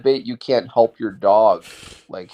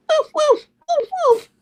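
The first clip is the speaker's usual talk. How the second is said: very high yappy woof woof; squeaky toy-dog barking; tiny dog barks. Pretend. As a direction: yip like a little Yorkie. Imitating a little Yorkie barking.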